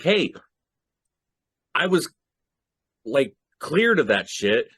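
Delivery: high, strained, insistent plea; hammed-up desperate plea, drawn out at the end; intense pleading. Cotton begging the world to clear his name, intense pleading. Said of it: "because he is so intense".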